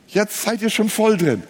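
usual speaking voice

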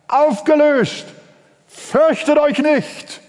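high, rising, triumphant sermon cry; preaching at full force. Acted. Preacher to congregation, triumphant high preaching. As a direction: proclaim fear is broken, rising. Preacher proclaims end of fear.

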